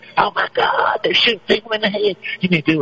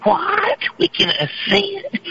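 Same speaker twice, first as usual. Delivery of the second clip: high, slow astonished question; hammed-up amazement; mock amazement; much slower than usual. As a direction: gasp it in wide-eyed wonder.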